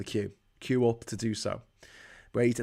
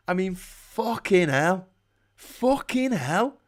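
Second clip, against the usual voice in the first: exasperated; 7 semitones higher, slower than usual. Real, not acted.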